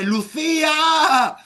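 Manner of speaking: high, loud, slow drawn-out name; long echoing call; drawn-out shout. Retold or imitated. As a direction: stretch the name out in a calling yell. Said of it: "Yo escuché".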